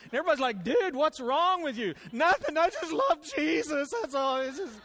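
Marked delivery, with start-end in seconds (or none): 0.6-1.9 loud, drawn-out mock concern; mock bewilderment. Gym-goers question the emotional lifter, mock bewildered. Onlookers react to him crying. 2.1-4.8 mock emotional outburst. Worshipper caught crying at the gym, hammed-up emotional. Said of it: "I start getting emotional in the gym".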